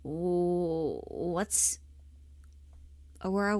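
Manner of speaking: normal talking voice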